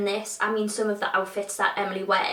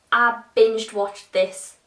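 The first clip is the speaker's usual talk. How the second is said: "binged watched" at half speed; very slow, careful; 4 dB louder, much slower than usual. Shown as a demonstration. Retrying a tricky phrase for viewers, very slow and careful. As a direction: sound out "binged watched" firmly and slowly. Retries a hard phrase slowly.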